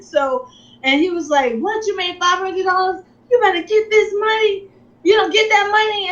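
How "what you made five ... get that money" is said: much higher, urgent and eager; excited husband. Retold or imitated. Husband telling his wife to withdraw the winnings, excited urging. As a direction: push her to grab the money now.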